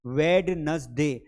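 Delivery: वेडनसडे drawn out slowly with the D sounded; wrong pronunciation shown. Shown as a demonstration. Teacher demonstrates the common mistake: slow वेडनसडे with the D sounded. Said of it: "ड को हम pronounce करने की कोशिश".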